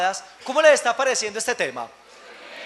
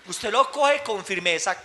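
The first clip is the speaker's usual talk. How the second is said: ordinary delivery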